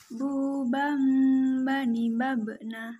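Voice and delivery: don't rush, sustain the ghunnah; nasal hold drill; held, unhurried. Shown as a demonstration. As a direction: hold "bam" and "bani" on the nasal. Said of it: "tahan suaranya dan jangan tergesa-gesa".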